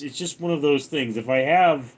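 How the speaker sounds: ordinary delivery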